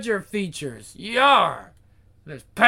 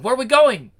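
higher and louder, eager; excited louder repeat; 5 semitones higher, 8 dB louder, faster than usual. Acted. Henchman eagerly repeats his question to the devil, louder. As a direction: ask again with a burst of eagerness.

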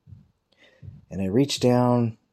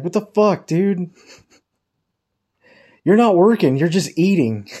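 high, loud mock exasperation; annoyed coworkers; 10 semitones higher, 7 dB louder than usual. Coworkers complain at the joker, loudly annoyed. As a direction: imitate fed-up coworkers protesting, pitched way up. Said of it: "everybody was getting annoyed at me".

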